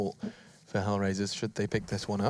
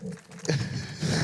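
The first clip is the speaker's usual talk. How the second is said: amused laugh. Real, not acted. Laughs at own slip.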